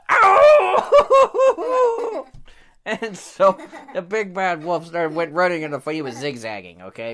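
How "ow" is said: very loud, high howl breaking up at the end; wolf's pained howl. Acted. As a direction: howl wildly in mock agony. Wolf shot again.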